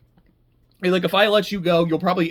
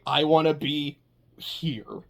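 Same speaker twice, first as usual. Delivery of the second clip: mock whisper; 3 semitones lower, 4 dB quieter, slower than usual. Voicing a devoted subordinate.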